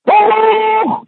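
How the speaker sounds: high, drawn-out exclamation; shouted blessing. Acted. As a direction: belt out the blessing on a high note. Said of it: "צועק".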